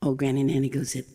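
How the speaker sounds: ordinary delivery, nothing marked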